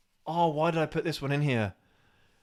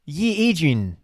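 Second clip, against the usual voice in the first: louder, botched South African attempt; weird South African accent; 2 semitones higher, 5 dB louder, slower than usual. Trying the quote for a friend, weird South African accent. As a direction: stretch "Ye Adrian" into odd South African vowels. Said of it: "No, you sound weird".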